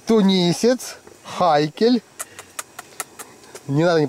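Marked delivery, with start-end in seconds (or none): none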